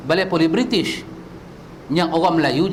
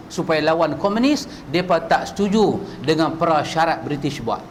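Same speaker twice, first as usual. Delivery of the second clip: usual speaking voice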